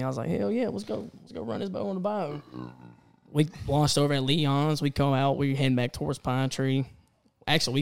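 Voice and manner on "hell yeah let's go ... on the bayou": higher, keen and eager; eager yes. Retold or imitated. Friend invited out on the bayou, eagerly accepting. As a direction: jump at the invite, pitched up high.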